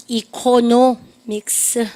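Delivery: ECONOMICS very slow, broken into separate syllables. Shown as a demonstration. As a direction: stretch out "economics" chunk by chunk. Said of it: "그러니까 economics".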